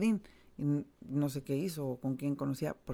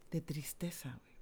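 whispered; 8 dB quieter than usual. Real, not acted. Overcome with grief recalling mother.

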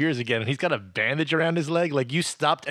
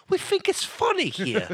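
high mock English accent; high mock English accent, insisting; 13 semitones higher than usual. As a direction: insist it's funny like a Brit. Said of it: "Things are different in England".

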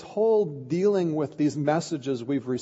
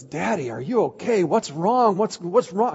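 put-on higher, louder worried child's questions; upset daughter, imitated; 5 semitones higher, 4 dB louder than usual. Daughter to her dad after he hit the wheel, imitated alarm. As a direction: mimic an alarmed daughter, pitch lifting on each question. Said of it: "she was really upset by it".

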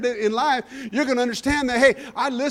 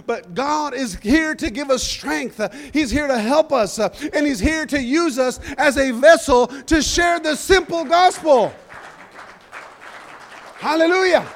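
full-force preaching; 3 semitones higher than usual. Real, not acted. Preacher urges church to share the gospel, building fervently. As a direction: build to a ringing gospel climax.

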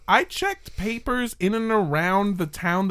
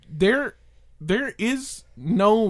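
normal talking voice

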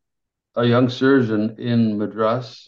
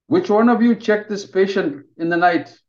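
higher, louder demanding doctor; stern senior surgeon; 9 semitones higher, 5 dB louder than usual. Acted. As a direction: interrogate the interns from above. Senior surgeon confronts his team.

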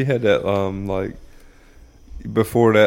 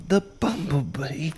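soft, high, slow British documentary voice; British narrator accent; 6 semitones higher, 8 dB quieter, slower than usual. Acted. British man describing animals on film, hushed British accent. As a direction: murmur it like a British wildlife narrator. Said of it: "this British dude, like, describing".

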